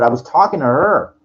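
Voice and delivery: loud, sharp retort at the guy; reactive snap. Retold or imitated. Man snapping back at a guy on the crosswalk, angry. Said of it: "I became reactive".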